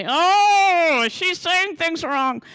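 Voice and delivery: high, slow whiny indignation; mock offended man. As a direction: whine it out like a huffy know-it-all. Mocking offended men in audience.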